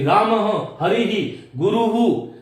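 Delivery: ramaha, harihi, guruhu; visarga echoes. Shown as a demonstration. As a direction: model the three endings carefully. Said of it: "मैं आपको बता रहा हूं".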